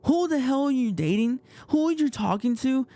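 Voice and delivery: high-pitched imitation of a jealous ex; indignant interrogation. Ex-girlfriend grilling him about who he's dating, mock indignation. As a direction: fire off mock-outraged questions, high then easing down. Ex-girlfriend's angry call, retold.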